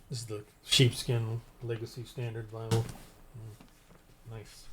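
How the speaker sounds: almost whispered appreciation; murmuring admiration while showing off his Bible; hushed admiration. Real, not acted.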